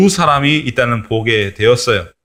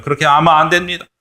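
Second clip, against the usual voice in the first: rising, faster scolding of the leader; mock scolding; 5 semitones higher, faster than usual. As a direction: scold the leader, getting higher. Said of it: "막 야단을 쳤었을 거예요".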